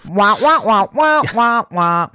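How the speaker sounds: Charlie Brown adult trombone wah; muffled tuba-like wah wahs; muffled wah wah talk. Retold or imitated. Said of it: "they were all gonna be tubas".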